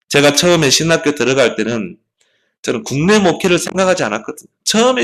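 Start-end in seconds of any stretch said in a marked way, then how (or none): none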